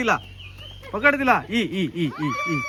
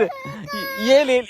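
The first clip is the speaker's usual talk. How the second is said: high calling; 11 semitones higher, much slower than usual. Real, not acted.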